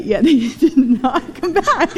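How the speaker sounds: slow, laughing through the words; chuckling confession to a child; laughing admission. Retold or imitated. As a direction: sheepishly laugh it out. Admitting awkward truth to child.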